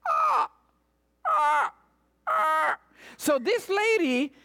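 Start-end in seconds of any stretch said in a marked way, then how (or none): 0.1-2.8 imitated crow caws; raven cry. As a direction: squawk like a raven. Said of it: "It's a raven".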